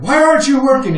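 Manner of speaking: high, loud outraged yell. Acted. Boss scolding workers who have stopped, yelling. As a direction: yell at slackers, pitch shooting right up. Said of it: "[name] is just yelling".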